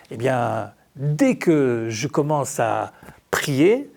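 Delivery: ordinary talk, nothing marked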